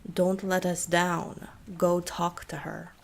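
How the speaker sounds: put-on slow, menacing Eastern European accent; thick Eastern European accent. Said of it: "asked the thick accent".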